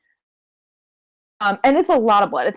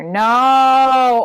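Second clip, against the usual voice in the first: sudden loud cry of protest; 5 semitones higher, 8 dB louder than usual. Real, not acted. Friend cutting in, a loud no. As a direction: blurt it out loud. Reacting to expected answer.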